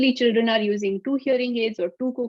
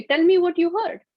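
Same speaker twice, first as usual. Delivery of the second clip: encouraging prompt; 7 semitones higher, 4 dB louder than usual. Acted. Prompting a confused child.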